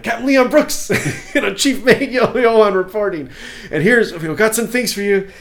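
high, loud, amused crew-member report; mock officer reporting. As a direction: report to the captain in hammed-up urgency. Acts out officer reporting to captain.

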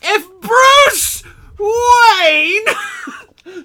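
huge high scream, words stretched way out; Sam Kinison, Bill Cosby. Acted. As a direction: scream each word long in a hammed-up comic impression. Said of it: "half Sam Kinison, half, half Bill Cosby".

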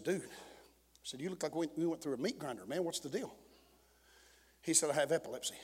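low, very soft pulpit storytelling; hushed testimony. Real, not acted. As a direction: drop the voice and draw the church into the story.

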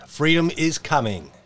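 ordinary talk

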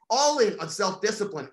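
normal talking voice

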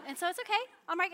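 ordinary delivery

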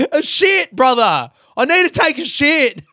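loud, high, hammed-up yell; exasperated outburst. Answering the camper about the toilet, exasperated mock shout. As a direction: blurt it out in mock outrage.

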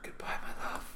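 hushed, whispered mock goodbye; very soft, hammed-up lover's farewell; loving farewell. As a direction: whisper it like a tender parting. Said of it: "so lovingly".

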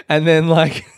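words breaking into real laughter; laughing. Real, not acted. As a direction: laugh through it, amused at the idea. Laughing at his own imagined fall.

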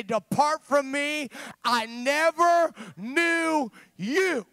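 high, loud impassioned sermon cry; fervent shouted preaching, slowing on key words; full-force preaching. Real, not acted. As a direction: preach it out to the back row, pleading.

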